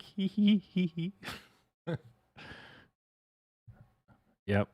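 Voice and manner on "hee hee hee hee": very high "hee hee" snicker; high giggle. Retold or imitated. Streamer reacting to alulinium tubes, high mock giggle. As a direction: imitate a squeaky giggle in short bursts. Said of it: "Every time I'm like".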